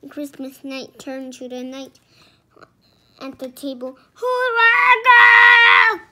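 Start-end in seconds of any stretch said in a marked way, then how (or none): 4.1-6.0 very loud, high, drawn-out call; booming. A narrator's reading. Knight calling to the table, booming. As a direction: boom it across the hall. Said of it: "she boomed".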